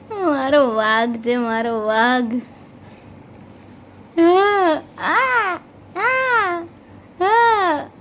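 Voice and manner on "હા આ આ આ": high drawn cooing sounds. Real, not acted. Mother cooing to baby.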